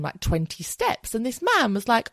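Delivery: ordinary talk, nothing marked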